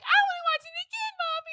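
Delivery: put-on very high, excited child's voice; little kid. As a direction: squeak it out like an eager little kid. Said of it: "There's kids literally like".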